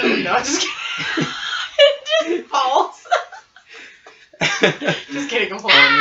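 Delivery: high, loud, helpless laughter; laughing. Real, not acted. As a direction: tease through real laughter. Jokes about dropping friend, cracks up.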